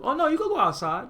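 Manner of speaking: high, light, falsely easygoing voice; mock-breezy parent. Parent letting punished child go outside, breezy and high.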